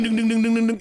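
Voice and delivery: high loud dinging; ding imitation. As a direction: ring it out like the game. Imitating game dings.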